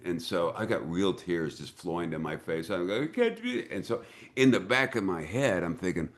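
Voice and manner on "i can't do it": very high, imitated tearful plea; mock crying. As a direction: squeak it out like a weepy actor. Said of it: "and so I'm crying".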